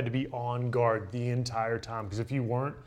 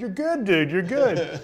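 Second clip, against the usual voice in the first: laughing reassurance; 7 semitones higher, 5 dB louder, slower than usual. Retold or imitated. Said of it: "I was like".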